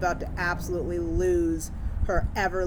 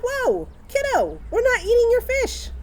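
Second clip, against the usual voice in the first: high, loud, alarmed protest; alarmed mom; 5 dB louder than usual. Retold or imitated. Mother stopping her daughter from eating the fish, alarmed.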